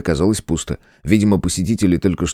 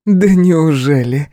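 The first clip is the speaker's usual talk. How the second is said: joyful Rangiku; 7 semitones higher, 3 dB louder, slower than usual. A narrator's reading. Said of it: "раздался радостный голос Рангику".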